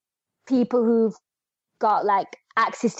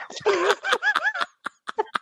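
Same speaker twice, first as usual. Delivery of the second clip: high-pitched burst of laughter; real laughter; 3 dB quieter than usual. Real, not acted. Podcast guest cracking up at their own Tormund quote, high laughter. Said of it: "apart from the laughter".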